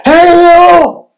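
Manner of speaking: high, loud call into the void; a shouted hello that goes nowhere; mock scream. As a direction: scream it out as if no one can hear. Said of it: "What's the value of screaming?".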